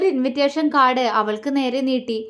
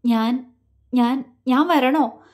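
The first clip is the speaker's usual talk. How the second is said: slow, halting, holding back tears; a slow, wavering question near tears; faltering voice; slower than usual. Acted. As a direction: stumble over the words, voice catching. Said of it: "അവളുടെ സ്വരമിടറി".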